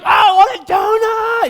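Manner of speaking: put-on high whiny child voice; whiny kid. Kids whining back at their dad, high whiny voice. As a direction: whine for a donut.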